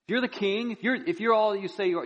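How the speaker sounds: high-pitched, pressing challenge; goading taunt. Acted. Criminal provoking the silent dying man, high goading taunt. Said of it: "he was trying to stir this silent".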